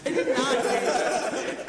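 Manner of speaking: loud, slow, laughing protest; laughing denial. Real, not acted. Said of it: "denial through laughter".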